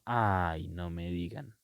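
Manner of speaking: long, low "ay", then softly "no me digan"; drawn-out ay. Shown as a demonstration. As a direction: stretch "ay" low and soft, as an interjection. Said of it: "Puede ser más largo o más corto".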